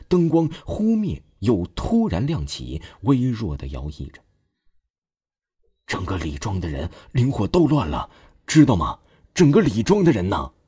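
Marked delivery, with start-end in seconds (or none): 5.8-10.5 put-on old man suddenly worked up and frightened; agitated old man. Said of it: "老者的情绪突然激动了起来".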